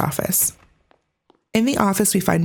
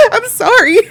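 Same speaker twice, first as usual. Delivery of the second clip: laughing apology; 10 dB louder than usual. Real, not acted. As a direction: laugh through the sorry. Laughs after loud director clip.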